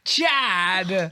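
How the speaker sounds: high, loud drawn-out call; drama teacher impression. Friend mimics their drama teacher calling him, high and loud. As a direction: call the name out big and stretched. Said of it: "That's pretty good".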